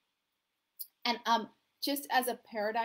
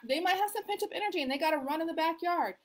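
ordinary delivery

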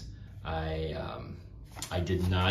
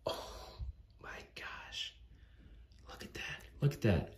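hushed, unvoiced-breath whisper of amazement; awed whisper; 9 dB quieter than usual. Real, not acted. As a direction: whisper it as if stunned by a gift. Awed at the Beyonder figure.

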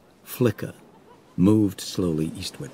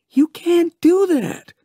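very high, loud, drawn-out objection; alarmed protest; 8 dB louder, slower than usual. Acted. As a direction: protest in a high, strained character voice. Vaughn protests the chief's plan.

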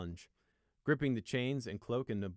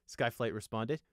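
ordinary delivery, nothing marked

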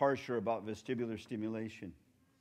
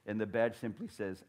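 ordinary delivery

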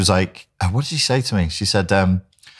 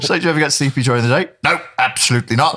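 retold exchange; 7 semitones higher, faster than usual. Doctor and patient retold.

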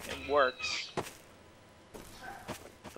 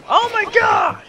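shout; 11 dB louder, slower than usual. Real, not acted. Getting shot back repeatedly.

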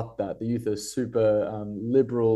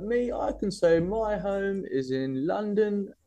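bad London accent, raised and slowed; 10 semitones higher, slower than usual. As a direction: attempt a London accent, not very well. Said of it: "It's a probably terrible London accent".

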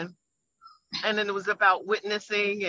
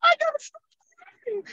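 very high, louder mock weeping voice; imitated crying; 14 semitones higher, 3 dB louder than usual. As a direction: mimic a woman wailing through her words. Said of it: "she cried so hard".

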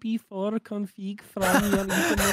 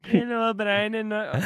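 put-on louder Indian-accented tutorial greeting; mock Indian accent; 5 dB louder than usual. Tutorial host greets his viewer, mock Indian accent. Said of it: "Please don't be Indian".

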